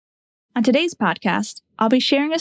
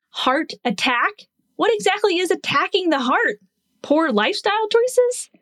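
mock puzzlement; 6 semitones higher than usual. Nurse voices confusion over the term heart attack, mock-puzzled. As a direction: play up the confusion with raised, questioning pitch.

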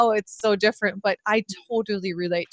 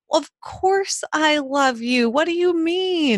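imitated incredulous protest; 7 semitones higher, 3 dB louder than usual. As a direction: imitate a baffled dad insisting. Retold dad protesting his love.

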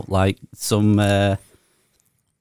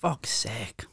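under-the-breath whispered "Fuck's sake"; whispered curse; 13 dB quieter than usual. Real, not acted. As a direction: whisper it in disgust to yourself. Groaning at the awful spread.

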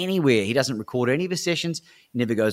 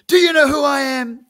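much higher and louder, proud; boastful shout; 9 dB louder than usual. Retold or imitated. Coach boasting down the phone, loud and high. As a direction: crow it like a swaggering coach.

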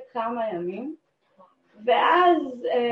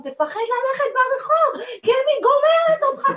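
yelled from the window, much higher, slowed; shouting threat; 13 semitones higher, 5 dB louder than usual. Retold or imitated. Woman yells from her window at the fleeing burglar, furious. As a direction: scream it after him down the street. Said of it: "ואני צועקת לו מהחלון".

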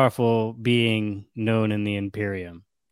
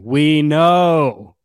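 curt loud interruption; 3 semitones higher, 8 dB louder than usual. Acted. Reverend Mother interrupts curtly.

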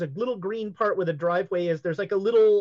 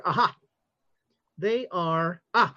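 ordinary delivery

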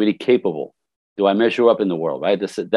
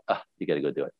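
quiet self-talk; 7 semitones lower, 10 dB quieter, much faster than usual. Retold or imitated. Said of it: "I go".

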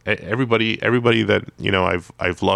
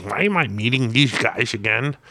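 higher, put-on grumpy celebrity voice; hammed-up impression of a cranky comics legend; Stan Lee; 4 semitones higher than usual. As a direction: put on a complaining caricature voice. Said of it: "that's my best one".

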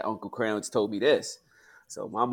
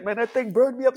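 put-on a touch of high, loud old man voice; mildly voiced pops; imitating pops; 6 dB louder than usual. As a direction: retell dad's grumble with heat. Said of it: "He's like".

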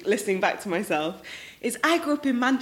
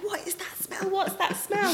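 high, softer imitation of puzzled visitors; mock disgusted alarm; 10 semitones higher, 6 dB quieter than usual. Visitors coming into the tuna-stunk kitchen, appalled. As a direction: squeak it like guests hit by a stink.

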